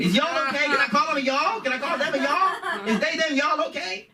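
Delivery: high-pitched comic pleading questions; mock questioning. Teacher checking pronouns with a student, high mock concern. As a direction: squeak out over-careful queries.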